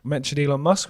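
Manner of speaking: ordinary delivery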